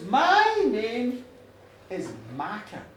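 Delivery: portentous, dropping very low on the name; solemn name reveal. Acted. Goddess finally tells him her name, solemn and low.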